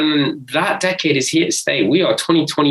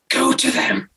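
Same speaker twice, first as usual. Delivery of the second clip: higher, hushed film-line quote; a touch of the tender movie line; quoting the film; 11 semitones higher than usual. Retold or imitated. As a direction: brother recalls the film's ending, lightly mimicking the line. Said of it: "he's going".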